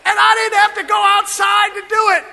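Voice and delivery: high, loud whoop then shouted joy; exuberant whoop. Real, not acted. Pastor rejoicing to the church that the toilet flushed, whooping loud. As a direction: shout it out in celebration.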